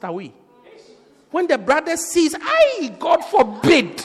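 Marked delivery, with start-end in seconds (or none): 2.3-4.0 high yelped "Ay", then drawn-out refusal; acted horrified refusal. Brother spots the faded beauty, mock horrified refusal. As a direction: recoil in mock horror, stretching the words. Acts brother rejecting the girl.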